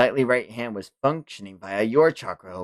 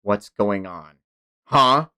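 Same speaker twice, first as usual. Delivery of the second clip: usual speaking voice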